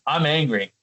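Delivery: ordinary delivery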